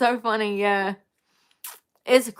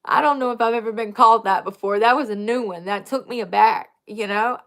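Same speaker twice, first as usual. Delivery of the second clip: usual speaking voice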